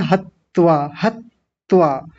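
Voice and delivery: "हत्वा" split in two with a doubled, held त; mistaken doubled consonant. Shown as a demonstration. Teacher imitating students' error, doubled त in "हत्वा". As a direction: say "हत्वा" as hat-twaa, stretched with a t on each side. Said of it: "दो त बोल रहे हैं".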